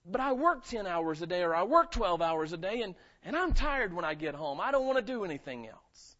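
mock complaining churchgoer, loud and pleading; whiny congregant. Pastor mimicking a tired member excusing himself, mock whining. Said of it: "we make it so complicated and say".

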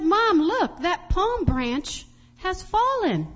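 put-on high, loud little-kid exclamation; excited child's call, stretched at the end; child calling to mom. Child calls out to her mom.

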